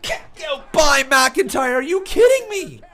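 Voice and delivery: screaming ringside play-by-play, high; wrestling commentator. Real, not acted. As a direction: call the near falls at full hype. Live play-by-play of match.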